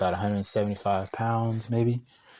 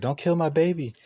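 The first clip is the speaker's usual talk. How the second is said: lightly voiced pleading mom, higher; protective mom; 7 semitones higher than usual. Landlord asking tenant to spare her son, protective. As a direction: imitate a mother's plea in a raised pitch. Mother pleading before the match.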